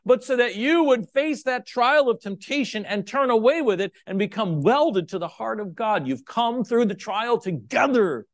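loud, high preaching voice; full-force preaching. Acted. Preacher to the congregation, loud high preaching.

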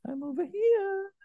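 very high teasing sing-song call; desire calling. Acted. Desire calling out to the sinner, high teasing call. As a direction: lure like a tempting voice. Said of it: "it's calling, going".

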